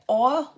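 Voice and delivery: Oil with a regional drawl; local pronunciation. Shown as a demonstration. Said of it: "I think I'm saying that right".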